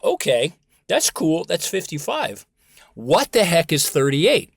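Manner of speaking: loud, high, slowed-down puzzlement; mock bafflement. Speaker acts out students puzzling over the numbers, mock-baffled and loud. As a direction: play up being baffled, loudly.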